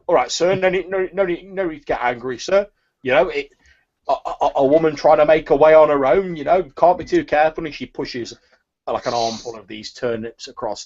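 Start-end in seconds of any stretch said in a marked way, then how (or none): 0.1-7.7 higher, nervous market woman calming a customer; nervous seller calming an angry buyer; placating turnip seller. Acted. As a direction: soothe him, all in a fluster.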